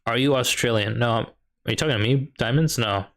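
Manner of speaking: normal talking voice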